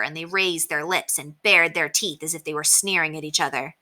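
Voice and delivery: usual speaking voice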